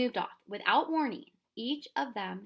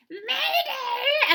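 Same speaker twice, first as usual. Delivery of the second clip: shrill squawk, much higher and louder; bird cry; 11 dB louder than usual. Acted. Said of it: "The wiffle bird cried".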